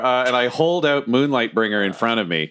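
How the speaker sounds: usual speaking voice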